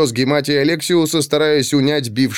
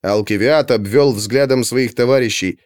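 ordinary delivery

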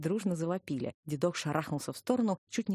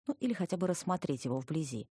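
ordinary delivery

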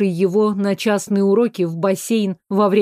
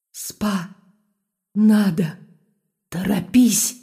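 eerie inhuman voice, fading in and out; urgent otherworldly plea; eldritch horror; slower than usual. A narrator's reading. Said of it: "Нечеловеческий голос".

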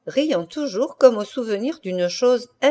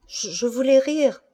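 higher, faltering excuse; painful, halting; 5 semitones higher than usual. A narrator's reading. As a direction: get it out with difficulty. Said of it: "elle prononça péniblement". (péniblement, en hésitant)

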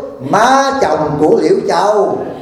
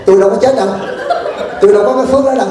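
climbing higher, a touch of a laugh; old woman's retort; 5 semitones higher, much faster than usual. Retold or imitated. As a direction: toss back the old woman's reply with amusement. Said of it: "Bà nói". (bà cụ đáp lại, giọng cao dần, hơi cười)

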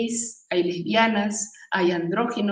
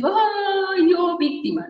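higher, louder whiny "Ay, yo víctima"; mock victim; 9 semitones higher, 4 dB louder, slower than usual. Speaker mocks the victim mindset, whiny and high. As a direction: ham up the self-pitying victim.

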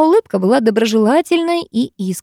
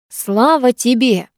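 booming; 5 semitones higher, slower than usual. A narrator's reading. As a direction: boom it out to the whole class. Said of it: "загремел он на весь класс". (громогласно, на весь класс)

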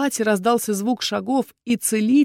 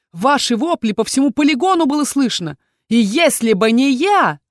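heated retort; 6 semitones higher, 6 dB louder than usual. Acted. Dai snaps back at rivals.

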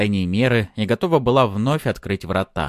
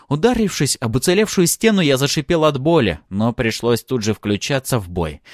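plain reading voice

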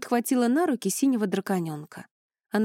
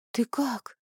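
gently worried question, slightly raised pitch; anxious; 4 semitones higher than usual. A narrator's reading. Wife checks on her stricken husband, anxious. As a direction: ask with tender concern. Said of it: "взволнованно спросила она".

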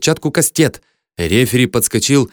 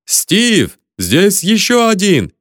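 high, drawn-out call across a hall; yelling; 9 semitones higher, 3 dB louder, much slower than usual. A narrator's reading. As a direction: holler a warning, stretching the words high. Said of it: "Бат снова завопил". (протяжный высокий вопль)

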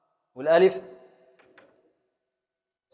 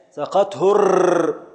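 slow scansion; 2 semitones higher, 3 dB louder, much slower than usual. Shown as a demonstration. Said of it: "ابدأ في التقطيع".

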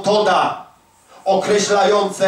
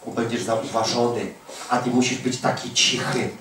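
softer, lower, coaxing tempter; preacher voicing Satan urging believers to stay quiet; sly Satan voice; 7 semitones lower, 6 dB quieter than usual. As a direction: hush them like a sneaky devil. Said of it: "Magister inżynier szatan mówi do ciebie".

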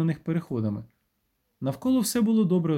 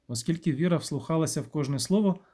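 ordinary delivery, nothing marked

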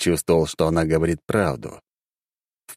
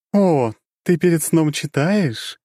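high, slow curious question; amused dark goddess; 11 semitones higher, 3 dB louder, slower than usual. Acted.

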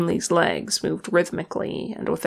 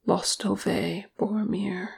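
soft murmured lament; hushed, gentle farewell; 6 dB quieter than usual. A narrator's reading. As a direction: murmur it softly, to the dead. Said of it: "Legolas murmured".

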